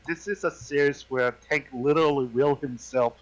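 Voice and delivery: usual speaking voice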